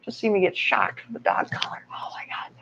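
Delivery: hushed, nervous whisper; whispering before the shock. Real, not acted. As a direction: drop to a whisper, dreading it.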